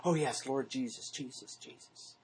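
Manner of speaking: softly murmured, fading chant of Jesus over and over; mock repetitive prayer. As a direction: mimic someone droning the same prayer word, sinking to a whisper. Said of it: "that is going".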